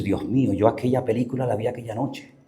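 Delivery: low, soft acted confession; guilty realization. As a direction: let it sink to a guilty murmur. Imagined guilty recollection before God. (confesión culpable, en voz baja)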